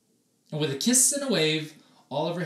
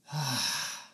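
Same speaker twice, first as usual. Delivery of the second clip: satisfied sigh; 5 dB quieter than usual. Acted. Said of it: "sighing a confident sigh".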